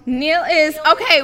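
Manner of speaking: usual speaking voice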